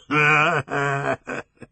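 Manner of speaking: put-on big drunken belly laugh; hearty drunken laugh; drunken guffaw. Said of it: "gave a great drunken guffaw".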